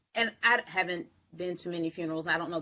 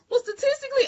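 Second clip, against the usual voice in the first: much higher, louder know-it-all mom voice; mock prim mother; 5 dB louder, faster than usual. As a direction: parody mom about to recite statistics. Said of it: "She was like".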